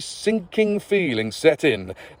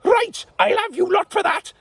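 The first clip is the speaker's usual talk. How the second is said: much higher hammed-up shout; mock shout. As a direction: threaten them like an angry knight. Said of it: "shouted Basil".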